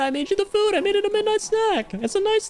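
squeaky pleading voice. Acted. Streamer voicing a figure pleading with sulky Kale, squeaky. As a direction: plead in a rising cartoon squeak.

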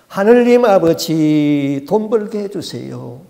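slow, loud begging prayer with hands pressed together; a slow loud beggar's prayer; pleading prayer. Retold or imitated. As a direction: draw out every word of the plea. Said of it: "어떻게 기도를 했냐면은".

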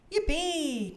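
high, drawn-out yippee cheer; storybook child's cheer. A narrator's reading. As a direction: let out a high little cheer. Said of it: "cheered Mandy".

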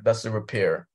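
ordinary delivery

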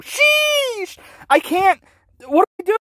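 high squeaky sheesh; mock panic. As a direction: yelp it in fake alarm. Panics about showing house.

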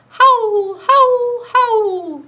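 high loud wolf howls. Retold or imitated. Librarian howling like a wolf for the kids. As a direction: howl like a wolf. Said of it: "make a sound like a wolf".

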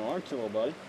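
ordinary talk

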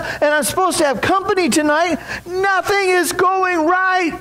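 high, mock-tearful daughter pouring it out; bawling and sobbing. A narrator's reading. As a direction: blurt it all out in a hammed-up sob story. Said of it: "she was bawling and sobbing all the way through this".

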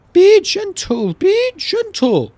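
very high, slow, loud wail; high-pitched pleading train coaches; crying coaches. A narrator's reading. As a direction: plead in a drawn-out falsetto. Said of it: "cried the coaches".

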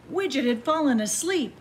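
plain reading voice

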